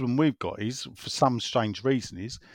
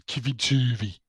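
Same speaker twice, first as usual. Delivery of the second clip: imitated Brummie, vowels squeezed in Turvey; Birmingham accent. Shown as a demonstration. Host showing how he learnt it, Birmingham accent. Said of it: "how I learnt Birmingham accent".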